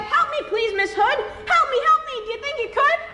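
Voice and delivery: high, desperate pig begging; pleading piglet. Acted. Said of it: "Pig cried".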